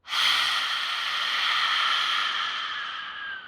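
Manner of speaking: very long, slow voiceless exhale; drawn-out airy release; deep sigh out. Shown as a demonstration. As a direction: sigh out steadily until the breath is gone. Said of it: "Then with a deep sigh out".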